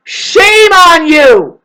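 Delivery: long-drawn high, loud yell; loud, high, drawn-out rebuke; scolding shout. Acted. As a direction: shout the shame, stretching it out. Scolding imagined beings.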